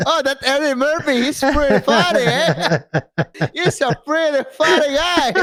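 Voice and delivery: high-pitched put-on father voice; chatty, hammed-up dad approval; impressed dad. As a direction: play a dad amused by a comedian. Friend plays dad approving of comedian.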